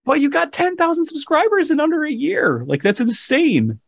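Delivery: high-pitched, amazed outburst; amazed excitement. Real, not acted. Cohost amazed by fast subscriber growth, excited and high.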